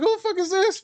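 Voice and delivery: very high, incredulous squeal; mock bewildered outburst. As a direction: blurt it in exaggerated high-pitched disbelief. Retold baffled reaction to late arrival.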